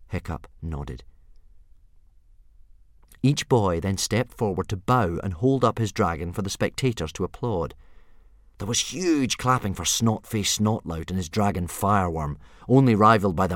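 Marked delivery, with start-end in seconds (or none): none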